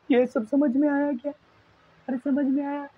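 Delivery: high-pitched, strained whine to the class; mock tearful. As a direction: plead as if about to cry, half joking. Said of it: "रो मत ज़्यादा". (रोती-सी ऊँची आवाज़ में)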